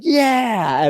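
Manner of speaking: high, drawn-out celebratory yell; excited cheer. Retold or imitated. Injured driver celebrates his shoulder popping back, excited. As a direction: imitate a teammate's elated, high-pitched cheer. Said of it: "then I get all excited".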